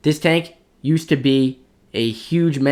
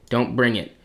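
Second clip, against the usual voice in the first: usual speaking voice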